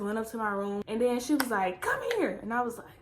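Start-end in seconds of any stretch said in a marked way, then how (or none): 1.8-2.4 high calling voice, mom summoning. Retold or imitated. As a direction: call her down like mom.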